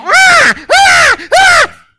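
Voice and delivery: put-on very high, loud screaming baby cries; imitated baby scream. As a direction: shriek like a fussing infant. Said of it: "Even when the kid's screaming".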